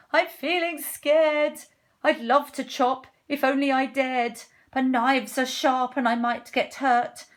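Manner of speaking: high, loud worried voice; timid cook, scared. Acted. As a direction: play a wimpy cook fretting.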